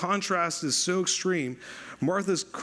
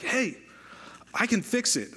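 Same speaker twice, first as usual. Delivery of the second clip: fix-it mode; 7 semitones higher than usual. Retold or imitated. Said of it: "Kevin Fix-It mode".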